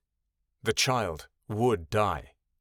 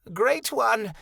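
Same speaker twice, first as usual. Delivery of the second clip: much higher frightened cry; cry of fear; 9 semitones higher than usual. A narrator's reading. Malachi crying out in fear before the Great One. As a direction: cry out in terror. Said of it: "cried Malachi in fear".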